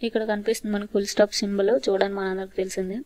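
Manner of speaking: usual speaking voice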